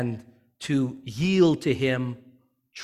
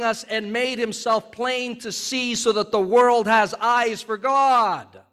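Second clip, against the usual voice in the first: high, rising preacher's build; fervent preaching; 7 semitones higher than usual. Acted. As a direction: preach it with growing fire.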